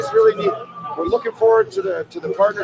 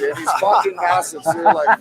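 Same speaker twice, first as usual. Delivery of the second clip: ordinary delivery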